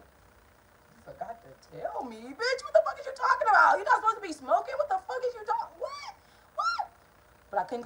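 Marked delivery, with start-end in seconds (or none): 0.0-2.2 slow, drawn-out incredulous question; retold slow-burning shock; stunned disbelief. Stunned reply to brother's confession. 2.2-6.9 very high-pitched, squeaking incredulity; shriek-pitched questions climbing higher and higher; hammed-up retold outrage; outraged disbelief. Outraged reaction to brother smoking.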